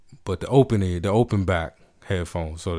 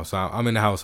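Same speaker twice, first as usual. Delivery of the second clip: ordinary talk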